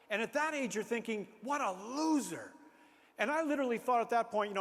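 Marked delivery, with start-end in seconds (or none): 1.4-2.5 slow, higher, drawn-out jeer; mock scorn. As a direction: draw it out in exaggerated disdain. Imagined scornful verdict on himself.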